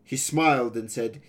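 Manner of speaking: ordinary delivery, nothing marked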